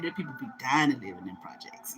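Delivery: hushed secret; whispered. Retold or imitated. Friend confiding about the projects, whispering. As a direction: whisper it like gossip. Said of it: "She said".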